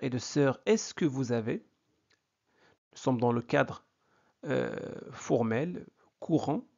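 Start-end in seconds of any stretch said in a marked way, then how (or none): none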